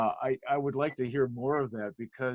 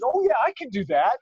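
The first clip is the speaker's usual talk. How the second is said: bright, louder, higher eager agreement; mock-confident yes, higher and louder; 10 semitones higher, 8 dB louder than usual. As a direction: toss it off like it's easy.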